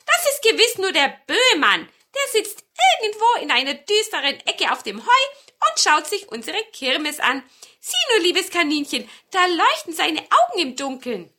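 high, loud hen voices; chattering hens. A narrator's reading. Hens explain the Böhmann to the marten, high and loud. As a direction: naive hens chattering happily. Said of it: "sagten die Hühner".

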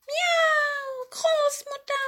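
very high, meowing cat voice; high-pitched storybook cat; old cat. Acted. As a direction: squeal it like a talking cat. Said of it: "sagte die Katze Murks".

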